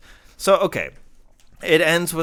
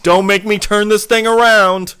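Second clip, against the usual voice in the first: louder, higher, mock stern dad; stern parent; 7 semitones higher, 9 dB louder than usual. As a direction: threaten like a fed-up parent. Parent threatening kids in car.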